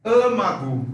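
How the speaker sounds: âmago with a closed first A; âmago said like emagu. Shown as a demonstration. Teacher giving a second closed-A example. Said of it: "O en la palabra".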